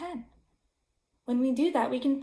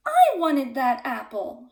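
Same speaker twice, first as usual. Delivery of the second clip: shouted; 3 semitones higher, 7 dB louder than usual. A narrator's reading. Goat shouting at friend who ate his apple. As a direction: yell it out at the thief. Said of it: "shouted Marvin".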